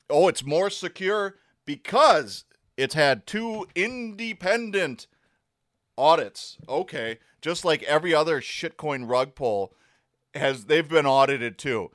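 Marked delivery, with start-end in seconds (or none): 0.1-6.6 slow, sarcastic drawn-out independent; mock the sales pitch, stretching independent; mocking quote. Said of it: "mocking the hype claim".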